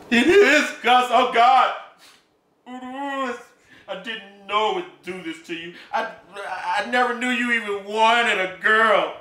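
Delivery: high, cracking pleas; begging him to understand; distraught pleading. Acted. Emma Jean pleading with Gus, high and distraught.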